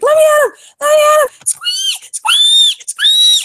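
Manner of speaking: very high, loud squeals from a tiny angry pig; furious squeaking piglet. Acted. Piglet Halfdan squealing to get at the man, furious squeals. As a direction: squeal like a piglet spoiling for a fight.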